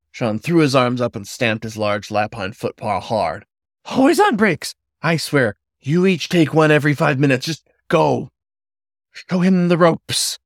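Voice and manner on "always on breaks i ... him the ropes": kettle whistle voice. A narrator's reading. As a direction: screech at him like a boiling kettle. Manager stamps and scolds.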